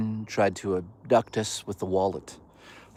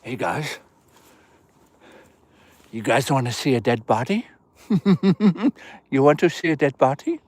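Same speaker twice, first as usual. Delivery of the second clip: creepy voice. Pretend. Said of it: "It's better than talking like this".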